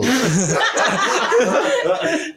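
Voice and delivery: a burst of high laughter. Real, not acted. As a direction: laugh out at the joke. Laughs at lotto joke.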